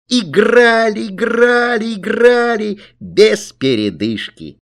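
high, drawn-out repeated words; exuberant narration. A narrator's reading. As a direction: stretch each word with mounting glee. Narrator builds exuberant endless play.

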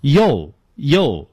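slow mispronounced "yo", with an o vowel; wrong U as "yo". Shown as a demonstration. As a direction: say "yo" in place of the letter U, drawn out, twice. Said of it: "错误的发成".

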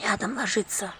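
soft embarrassed half-whisper. Acted. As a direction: hush it out, embarrassed. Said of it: "полушёпотом заметила баба".

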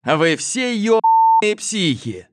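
panicked shout, slowed down. Acted. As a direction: yell it while fleeing. (паническийкрик)

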